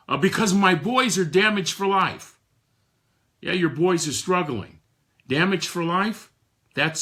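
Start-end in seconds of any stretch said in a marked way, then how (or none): 0.2-2.4 hurt answer. Retold or imitated. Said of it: "why are you stuck".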